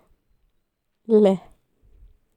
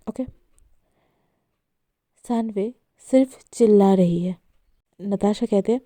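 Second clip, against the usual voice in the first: ordinary delivery, nothing marked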